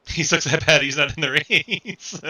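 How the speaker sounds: loud, high, slow laughing call; laughing shout. Real, not acted. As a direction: belt it out through laughter. Teasing about Hunter, loud and laughing.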